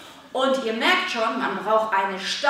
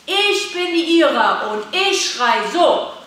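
loud, raised demonstration call; 4 semitones higher, 5 dB louder than usual. Shown as a demonstration. Coach showing children how to shout their name, loud. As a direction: announce yourself boldly with a strong voice. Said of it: "müsst ihr jetzt gleich rufen".

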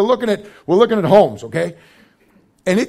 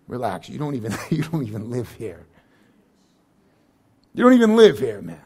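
gentle reassurance; 8 semitones lower, 5 dB quieter than usual. Retold or imitated. Voicing the Lord's reassurance.

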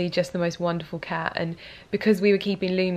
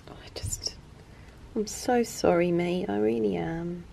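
softer apology, near tears; holding back tears; 6 dB quieter than usual. Real, not acted. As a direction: apologise to the cat while fighting tears. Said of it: "trying so hard not to cry".

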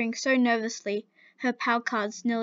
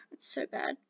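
a soft embarrassed whisper to oneself; whispered aside; 5 semitones lower, 10 dB quieter than usual. Real, not acted. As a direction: breathe it out, cringing at the line. Aside about own story.

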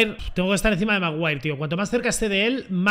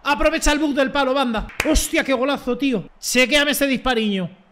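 excited celebration; 5 semitones higher than usual. Real, not acted. Celebrating his own goal. (celebración de golazo, aguda y fuerte)

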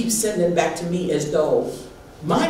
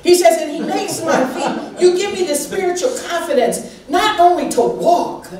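higher, louder, fervent sermon voice; full-force preaching; 8 semitones higher, 3 dB louder than usual. Acted. Pastor preaching to her church at full force. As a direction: preach it to the rafters, building.

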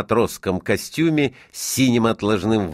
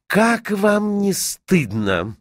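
slow, high-pitched indignation; shocked reproach; 12 semitones higher, much slower than usual. A narrator's reading. Student shaming the tattling boy, indignantly reproachful. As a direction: draw it out reproachfully.

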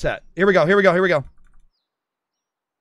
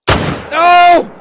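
high, loud cry of dismay; anguished shout; 8 semitones higher, 8 dB louder than usual. Real, not acted. Hunter misses next shot, anguished shout. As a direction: yell in real frustration.

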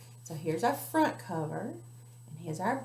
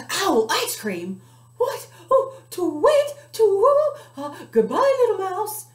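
very high, loud shriek with a whimper; hammed-up frightened owl; panicked owl; 12 semitones higher, 12 dB louder than usual. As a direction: flap off in terror.

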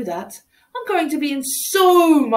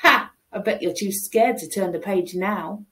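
ordinary delivery, nothing marked

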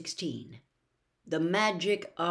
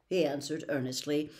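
ordinary delivery, nothing marked

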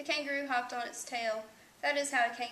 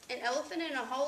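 ordinary delivery

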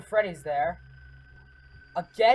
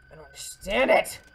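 ordinary talk, nothing marked

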